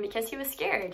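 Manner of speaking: usual speaking voice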